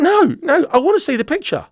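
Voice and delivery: high, insistent demand; insistent protest. Retold or imitated. Suspect demanding proof from sergeant, insistent protest. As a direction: re-enact standing your ground, voice pitched well up. Retold quarrel, demanding the picture.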